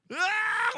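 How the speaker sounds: high, very loud exasperated yell; frustrated scream. Acted. Believer fed up with his bosses, frustrated scream. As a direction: scream out all the pent-up irritation. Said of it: "막 짜증나는데".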